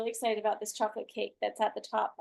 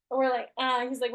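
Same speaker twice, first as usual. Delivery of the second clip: ordinary delivery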